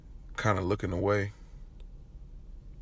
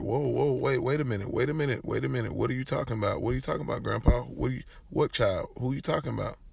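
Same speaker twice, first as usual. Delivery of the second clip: higher, tumbling repeated questions; alarmed disbelief; 4 semitones higher than usual. Retold or imitated. Visitor interrupts grandpa's rant, alarmed disbelief. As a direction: act out being caught off guard. Stunned reply to grandpa's news, retold.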